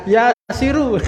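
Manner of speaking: mock wrong reading. Shown as a demonstration. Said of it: "Wahai Siru kan nggak ada".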